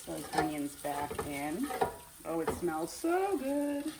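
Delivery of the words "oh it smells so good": slow, high, delighted sigh of pleasure; drawn-out pleased oh at the smell; savoring a smell. Real, not acted. Savoring the smell of frying rice.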